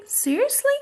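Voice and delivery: very high, sarcastic question. Shown as a demonstration. Teacher shows students the tone, sarcastic. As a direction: pitch it way up in mock disbelief. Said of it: "I'm being sarcastic".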